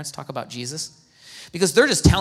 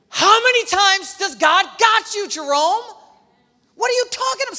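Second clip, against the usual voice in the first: much higher, louder mock scolding; exasperated rebuke; 14 semitones higher, 6 dB louder than usual. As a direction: scold yourself the way God would, half joking. God rebuking the preacher himself.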